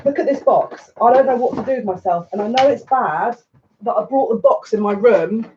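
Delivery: high, giddy rush; excited, words tumbling out high; too excited. Real, not acted. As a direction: gush with real excitement. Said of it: "but I'm too excited".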